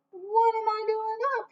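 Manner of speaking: high, startled question; alarmed. A narrator's reading. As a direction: gasp it out in alarm. Groundhog alarmed at his shadow.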